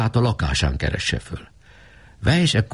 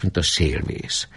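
ordinary delivery

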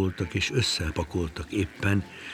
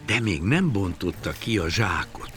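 usual speaking voice

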